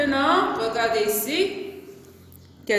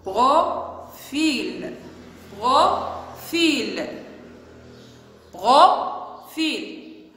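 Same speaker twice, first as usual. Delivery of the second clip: dictation; much slower than usual. Shown as a demonstration. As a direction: split in two syllables, "Pro... fil". Teacher dictates word for writing. (dictée, « Profil » en deux syllabes détachées)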